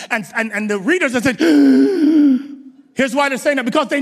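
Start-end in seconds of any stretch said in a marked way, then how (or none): 1.4-2.7 loud sharp gasp; shocked gasp. Pretend. Readers of Judges reacting to Samson, loud gasp.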